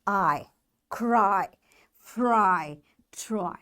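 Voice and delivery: low, separate "I, Cry, fry, try", long I drawn out; long I sound. Shown as a demonstration. Teacher recaps the Y rule, long I held. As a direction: list "Cry, fry, try" with a lengthened, falling I. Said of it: "will be pronounced as long sound of".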